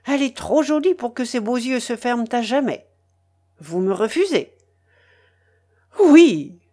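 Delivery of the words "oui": sharp high cry of horror, loud; horrified cry. A narrator's reading. Mélanie refusing the pirate in horror. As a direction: recoil with a loud horrified yes. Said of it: "avec un geste d'horreur".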